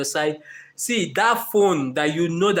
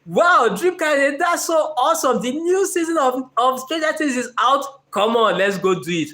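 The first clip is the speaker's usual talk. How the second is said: much higher, louder, gushing delight; sudden excitement; 8 semitones higher, 3 dB louder than usual. Retold or imitated. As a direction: burst into childlike glee at the news. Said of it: "sudden burst of energy and excitement".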